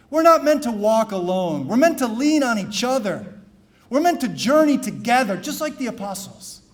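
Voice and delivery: loud, fervent refrain; impassioned pulpit refrain; preaching. Acted. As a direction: proclaim it to the church.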